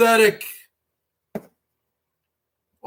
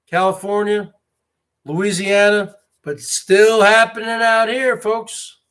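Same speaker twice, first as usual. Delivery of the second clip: usual speaking voice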